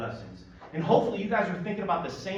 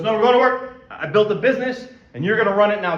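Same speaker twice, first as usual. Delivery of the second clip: louder, higher announcement; loud declaration; 4 semitones higher, 8 dB louder than usual. Retold or imitated. Friend tells sleepy pal they're going to work, loudly. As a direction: boom it out like a boisterous buddy. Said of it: "he's a very boisterous and loud man".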